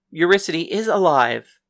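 plain reading voice

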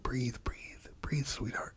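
soft, eerie whisper. Retold or imitated. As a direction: breathe it out, barely voiced. Killer soothing his victim.

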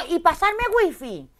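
loud, high, drawn-out indignant demand; stretched-out, high-pitched mock outrage; angry demand. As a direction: re-enact an outraged demand, slowly and loudly. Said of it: "estaba enfadada".

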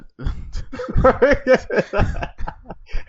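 high, breaking burst of laughter; loud laughing fit. Real, not acted. Host cracking up after failed ASMR attempt, laughing loudly.

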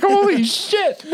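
high, slow stunned gasp. Retold or imitated. Reacting to the kid's insult, high slow shocked. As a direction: drag it out in disbelief.

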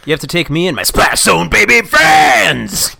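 gruff demand building to a huge yell; throat-straining shout. Acted. Child demanding dad take the splash zone babies, straining shout. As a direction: stretch the last word into a raw yell. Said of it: "It hurts so bad".

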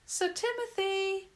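put-on high sustained girl's call. A narrator's reading. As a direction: sing the name out to summon someone. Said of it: "Clara called out".

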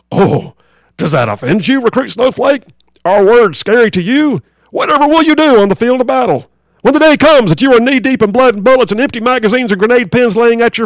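drill sergeant. Acted. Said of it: "drill sergeant".

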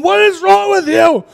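high, stretched-out demand; exasperated wife. Retold or imitated. Acts out alarmed wife.

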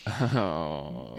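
ordinary talk

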